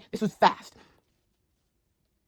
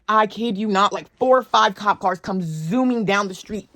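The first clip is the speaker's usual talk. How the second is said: usual speaking voice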